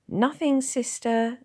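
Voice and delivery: high, loud nervous nurse; shaky. Acted. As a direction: answer the sister anxiously. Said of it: "said the nurse shakily".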